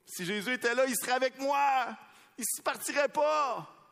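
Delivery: high, loud pleading cry; a grieving relative crying out to the pastor; grieving plea. Retold or imitated. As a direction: voice the anguish of a mourner. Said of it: "qui me regardent en disant".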